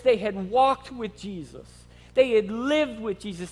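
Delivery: ordinary talk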